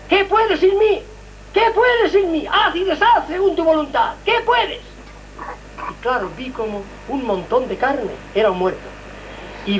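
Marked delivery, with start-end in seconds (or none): 0.1-4.8 higher, solemn voice of God asking; a lofty voice questioning from above; divine voice. Retold or imitated. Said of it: "Y una voz me dice".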